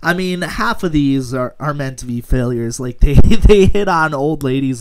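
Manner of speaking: ordinary talk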